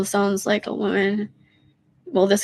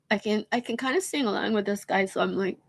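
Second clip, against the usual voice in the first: ordinary talk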